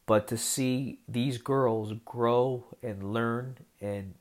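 usual speaking voice

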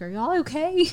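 high-pitched imitation of a checking-in friend; lightly mock a worried phone check; concerned caller. Retold worried caller, played for laughs.

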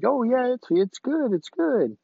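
high, breezy voice of the boss; mock boss voice. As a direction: chirp it, imitating the easygoing boss. Boss voiced in retold story.

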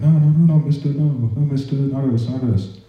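high, mock-panicked pleading. Soldiers begging Iraqi police it wasn't them, high mock panic. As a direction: plead like scared soldiers denying it. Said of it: "we're like".

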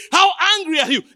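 usual speaking voice

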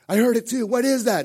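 high, loud imitation of a scared child; hammed-up childish panic; panicked kid. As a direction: cry out in mock alarm. Imitating kids panicking at the growl.

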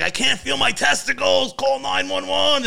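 high, loud imitation of a panicked friend; hammed-up cry, slow on the last words; mock panicked yell. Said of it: "he's like".

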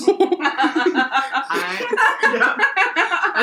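laughing hard mid-sentence; words breaking into laughter. Real, not acted. Laughing while telling story.

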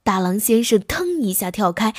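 ordinary delivery

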